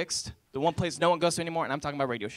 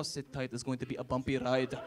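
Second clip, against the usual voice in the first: mock pilot line; 6 semitones lower, 6 dB quieter than usual. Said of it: "I just wanted to go".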